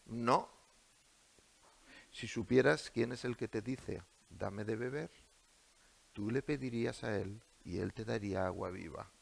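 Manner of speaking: calm, soft reply of Jesus; meek reply. Acted. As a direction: answer meekly and softly. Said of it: "le contesta en el mismo término".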